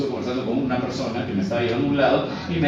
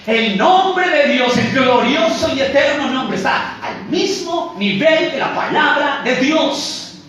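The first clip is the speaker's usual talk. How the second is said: high, loud, stretched proclamation; ringing pulpit emphasis, slow on key words; preaching at full force; 10 semitones higher, 7 dB louder than usual. Real, not acted. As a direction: proclaim it with rising fervor to a congregation.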